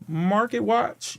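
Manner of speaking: Market Watch lifted at the end like a question; "Market Watch" with an asking upturn; questioning rise. Real, not acted. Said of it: "I said it like a question".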